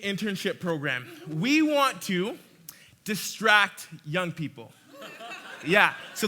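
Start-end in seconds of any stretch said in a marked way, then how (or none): 1.3-4.7 slow, with a pause before distract; playful reveal, deliberately slow; dramatic punchline. Acted. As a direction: hold the pause, then land it. Punchline of the story.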